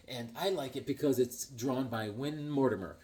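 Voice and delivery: ordinary talk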